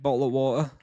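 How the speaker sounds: bottle of water run together in a casual English accent; genuinely British "bottle of water", words slurred into one another. Shown as a demonstration. Streamer shows chat his English way of saying it, English accent. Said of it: "said it in an English accent".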